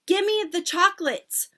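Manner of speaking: high, loud demand; insistent high-pitched shout; boy shouting. A narrator's reading. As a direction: yell it like a bratty child. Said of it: "shouted Bruno".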